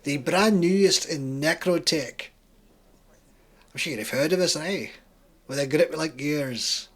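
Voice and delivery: proud Scottish salesman; thick Scottish accent. Acted. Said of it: "He has a thick Scottish accent".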